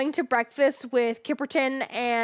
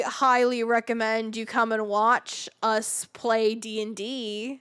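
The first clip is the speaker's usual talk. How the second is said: normal talking voice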